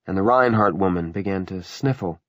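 plain reading voice

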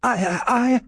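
high, halting stammer of a scared clerk; frightened stammer. A narrator's reading. Dawes cowering as Fettes leans over him, stammering high. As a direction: stumble over the words in fright. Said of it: "he stammered".

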